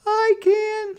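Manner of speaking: put-on very high, loud little girl; little sister voice. A narrator's reading. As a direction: squeak it like a small stubborn girl. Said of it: "said Sister".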